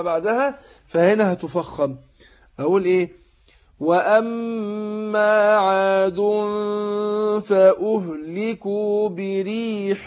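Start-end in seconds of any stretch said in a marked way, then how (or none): 3.8-10.1 slow chanted tajweed recitation, heavy nasal hum; Quran recitation. Shown as a demonstration. Teacher demonstrating recitation to students, slow chant. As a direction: recite slowly, drawing out the ghunna. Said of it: "شوف فخمت الغنة إزاي".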